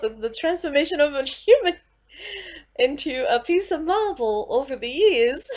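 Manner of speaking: ordinary talk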